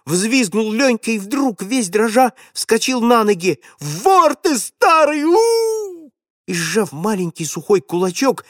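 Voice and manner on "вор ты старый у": high, slow, sobbing shout; trembling boy screams at the old thief through tears; furious crying scream. A narrator's reading. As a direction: yell it through tears, shaking.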